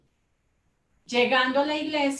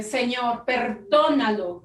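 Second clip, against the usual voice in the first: louder prayer with the last word stretched; retold loud plea; 5 dB louder, slower than usual. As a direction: cry out to God, drawing out the plea. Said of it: "usted va a decir en voz alta". (súplica en voz alta, alargada)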